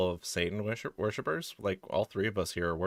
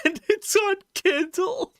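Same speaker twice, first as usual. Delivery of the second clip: laughing; 5 dB louder, slower than usual. Real, not acted. Laughing through line.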